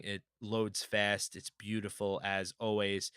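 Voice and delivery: usual speaking voice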